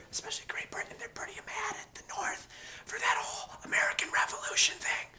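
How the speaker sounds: whispered conspiratorial aside; stage whisper. Acted. Teacher confiding Britain's grudge, whispered aside. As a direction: lean in and whisper the secret.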